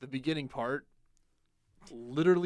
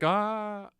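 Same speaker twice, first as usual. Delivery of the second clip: high, loud drawn-out cry; hammed-up cry of defeat; mock dying cry; 8 semitones higher, 7 dB louder than usual. Necromancer beaten by the player, mock dying cry.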